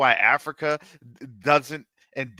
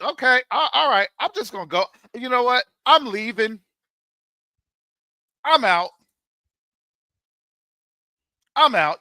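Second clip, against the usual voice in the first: raised, louder put-on voice of people storming off; mock flounce-off; 6 semitones higher, 6 dB louder than usual. Preacher mocking people who quit, hammed-up huffy exit. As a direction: act out someone announcing they're leaving in a huff. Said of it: "Y'all think".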